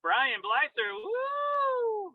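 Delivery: drawn-out announcer name call, high woo; Sean Wilkerson. Retold or imitated. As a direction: call each name slowly, then whoop high. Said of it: "his style was like".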